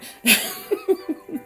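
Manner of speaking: squealing laugh way up high. Real, not acted. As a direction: laugh at your own rambling. Laughing at herself while signing off.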